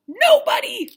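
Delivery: high, loud exclamation; loud comic outburst; shouted answer. Real, not acted. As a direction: belt it out as the obvious answer. Exclaimed answer to rhetorical question.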